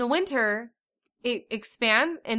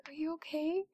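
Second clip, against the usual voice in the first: much softer, tender question; gentle concern; 5 semitones higher, 13 dB quieter than usual. Retold or imitated. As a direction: ask softly with care. Checking on daughter.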